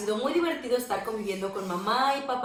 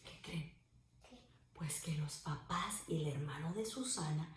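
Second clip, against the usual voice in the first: whispered, very soft; 5 semitones lower, 12 dB quieter than usual. Acted. Teacher whispering to the kids, storytelling whisper. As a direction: lean in and whisper the secret.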